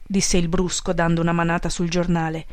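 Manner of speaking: ordinary delivery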